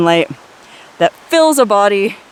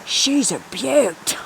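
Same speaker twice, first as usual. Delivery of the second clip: slow, hammed-up fake Australian accent; lurking near hot springs, a mock Australian Steve Irwin drawl; cheesy Steve Irwin accent; 5 dB quieter, much slower than usual. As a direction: ham up a wildlife host's Australian twang. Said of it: "cheesy fake Australian Steve Irwin accent".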